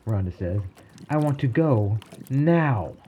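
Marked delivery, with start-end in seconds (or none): 1.1-2.9 higher, slow and emphatic; insistent demand. Acted. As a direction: spell out the demand, stretching the words. Rhonda urgently demands to leave.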